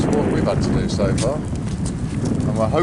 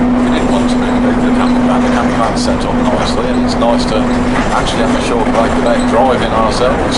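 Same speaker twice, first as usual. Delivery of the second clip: raised voice over engine noise; loud; 7 dB louder than usual. Real, not acted. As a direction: speak up over the bus. Talking on a noisy bus.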